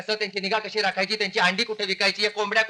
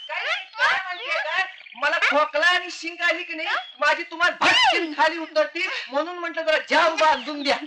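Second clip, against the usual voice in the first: whiny servant; 7 semitones higher than usual. Acted. Servant pleading in high voice.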